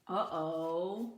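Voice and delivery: ordinary delivery, nothing marked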